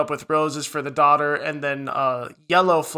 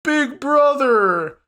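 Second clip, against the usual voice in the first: high, loud and very slow mock voice; hammed-up starstruck little brother; mock proud greeting; 10 semitones higher, 5 dB louder, much slower than usual. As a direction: stretch it out in a goofy high voice. Mocks nephew's hoped-for greeting.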